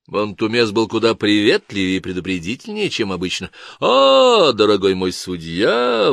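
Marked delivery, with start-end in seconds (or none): 3.8-6.1 nasal, delighted exclamation. A narrator's reading. Chief guard greets the judge, nasal exclamation. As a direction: exclaim it through the nose in mock delight. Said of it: "воскликнул он своим гнусавым голосом".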